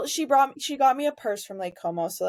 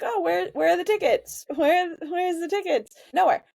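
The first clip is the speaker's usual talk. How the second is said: higher, mildly exasperated quote; retold ticket hunt; 8 semitones higher than usual. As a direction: act out hunting for something and coming up empty. Retold frantic ticket search.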